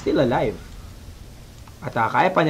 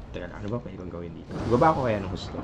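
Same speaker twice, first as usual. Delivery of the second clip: much softer, quick aside off-mic; lowered voice; 9 semitones lower, 10 dB quieter, faster than usual. Real, not acted. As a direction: mutter it away from the microphone. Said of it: "humihina yung aking boses".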